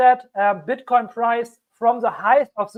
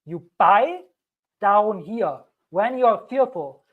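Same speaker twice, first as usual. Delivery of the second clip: normal talking voice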